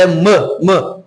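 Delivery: "m" as a short, closed "mâ"; closed vowel. Shown as a demonstration. Teacher returns to the target sound, closed vowel. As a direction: clip "m" to a closed "mâ" without the glide. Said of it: "com o som mais fechado".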